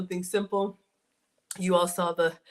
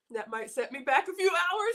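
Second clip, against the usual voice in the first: high, giggling through the words; laughing; 10 semitones higher than usual. Real, not acted. Joking to viewers about the calories, laughing as she speaks. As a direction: laugh at her own indulgence.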